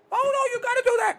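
very high put-on child's voice; mock little-girl protest, high; granddaughter protesting. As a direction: mimic a kid's squealed objection. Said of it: "she goes crazy".

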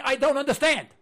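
"I don't understand" pushed out loud; emphatic. Shown as a demonstration. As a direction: declare "I don't understand" with mild exasperation. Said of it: "To this day, I'm saying".

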